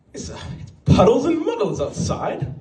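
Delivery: high-pitched hammed-up British accent; mock British. British coworker making up rain metaphors, mock British accent. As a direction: improvise like a daffy Brit. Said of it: "You know how British people".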